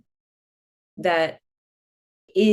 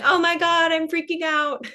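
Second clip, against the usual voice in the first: freaking out; 11 semitones higher than usual. Retold or imitated. Retelling her panic to the elders, high mock freak-out. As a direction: replay your own jungle panic, pitched way up. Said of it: "where I've been like".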